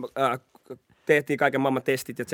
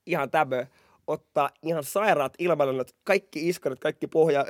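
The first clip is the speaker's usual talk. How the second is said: ordinary talk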